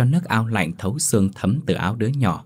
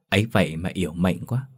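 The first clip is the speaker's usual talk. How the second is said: plain reading voice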